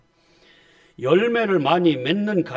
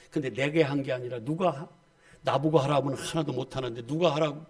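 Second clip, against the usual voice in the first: usual speaking voice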